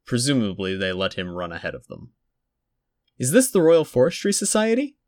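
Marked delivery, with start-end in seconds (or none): 3.2-5.0 put-on high small boy's voice; a polite little boy calling out; child's voice. A narrator's reading. Small boy at reception, high and polite.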